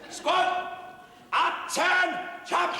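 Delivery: high parade-ground bark; shouted military command; drill shout. Acted. Sergeant orders the squad through rifle drill, shouting. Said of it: "all this shouting all the time".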